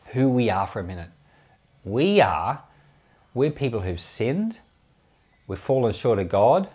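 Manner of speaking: ordinary talk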